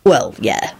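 low-pitched awkward admission; embarrassed. A narrator's reading. Lupin owning up to his costume, embarrassed. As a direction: admit it with some embarrassment. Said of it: "he replied with some embarrassment".

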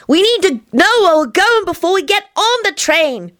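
put-on high, insistent little-kid voice; high-pitched childish persistence; obstinate child. A narrator's reading. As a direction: dig in stubbornly like a small child. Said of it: "Tony continued obstinately".

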